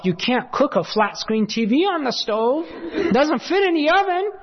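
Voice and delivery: rising high, incredulous retold thought; baffled disbelief. Preacher replaying his thoughts about Al's TV repair, incredulous. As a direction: climb in pitch as the absurdity mounts.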